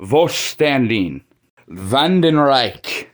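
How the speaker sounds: Volkstanding and Vandenreich said slowly with German sounds; slow, precise German model words; German pronunciation. Shown as a demonstration. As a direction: carefully demonstrate the German way. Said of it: "That's how it's pronounced".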